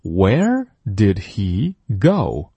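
Where did he go slowly, every sound; 慢速领读; slow model read. Shown as a demonstration. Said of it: "再次跟读".

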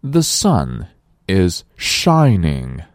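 slow, careful model sentence for learners; slow model reading. Shown as a demonstration. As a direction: read it out slowly for the class. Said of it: "我们再来大声朗读". (慢速示范朗读)

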